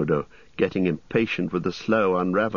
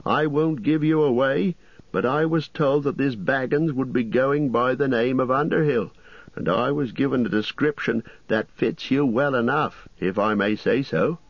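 higher, bustling landlord voice; fussy innkeeper; 8 semitones higher than usual. Acted. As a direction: play the chatty innkeeper, pitched up.

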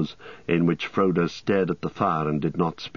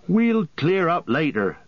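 Merry's voice; 9 semitones higher than usual. A narrator's reading. Merry speaks, high voice.

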